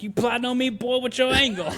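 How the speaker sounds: suspicious tough marine. Retold or imitated. Major turning on the hugging kid, loud mock suspicion. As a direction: grill him like a paranoid drill sergeant.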